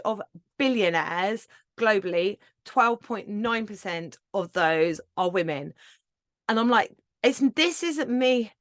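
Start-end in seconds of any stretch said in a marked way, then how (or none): none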